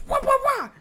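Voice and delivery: high, loud mock yells of wah; hammed-up fighting shouts; silly cartoon combat yells; mock battle cries. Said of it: "he just goes".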